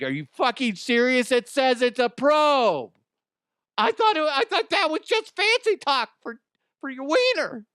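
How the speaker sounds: high, loud, squeaky outraged voice; mock outraged victim. Imagined condom victim complaining in a high outraged squeal. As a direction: squeal it like a furious dupe. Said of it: "Be like".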